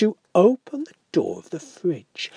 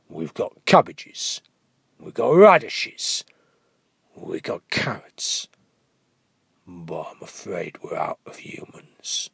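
much lower, softening to whisper; sheepish ogre; 11 semitones lower than usual. Acted. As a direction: confess it meekly. Ogre apologises to wife.

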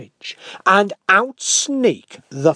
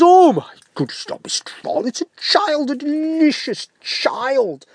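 much higher, louder gleeful ogre; excited ogre; 10 semitones higher, 5 dB louder than usual. Acted. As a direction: squeal with greedy delight. Ogre delighted at child.

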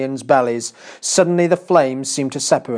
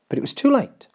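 plain reading voice

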